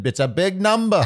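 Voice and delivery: put-on high, theatrical old musical flourish; hammed-up showbiz voice. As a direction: announce it like a big stage number. Hams up the showbiz phrase.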